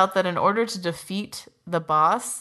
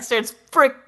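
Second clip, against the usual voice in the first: ordinary delivery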